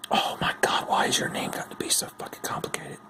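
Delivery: frustrated whisper. Real, not acted. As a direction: hiss it under your breath.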